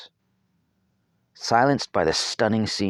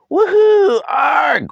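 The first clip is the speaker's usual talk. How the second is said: whoop then yelp; 10 dB louder, much slower than usual. A narrator's reading. Boy blown over, falls.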